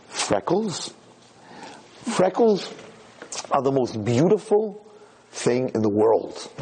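gentle, slowed old-man voice, lightly voiced; tender old man. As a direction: soothe the freckled boy like a kind grandfather. Old man comforting a child.

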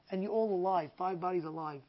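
low, very soft retold wonder; subdued amazement, retelling the rescuer; hushed awe. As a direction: drop to a quiet, awed voice. Rescuer's tearful explanation, retold.

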